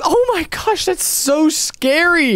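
high, gasping disbelief; shocked. Acted. Friend shrieks reading the death note, shocked.

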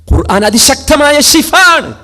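high, loud and slow, declaiming the verse; preaching at full force. Acted. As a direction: proclaim the verse to the hall. (ഉച്ചത്തിൽ പ്രസംഗം)

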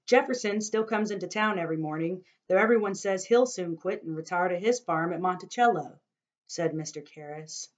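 expressive novel reading, voicing each figure; story narration. A narrator's reading. Narrator reading a chapter to listeners, storytelling voice. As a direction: tell the story to listeners, dramatically.

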